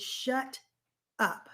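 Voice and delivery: "Shut", a pause, then "up" hit hard; telling off the inner voice, "Shut ... up"; firm command. Shown as a demonstration. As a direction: split "Shut up" into two firm beats. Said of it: "I want you to tell that voice".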